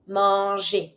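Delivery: "Manger" as "mon-zhay"; low, careful "Manger" with French J sound; soft G. Shown as a demonstration. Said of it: "G plus E makes it sound je".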